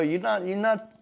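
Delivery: ordinary talk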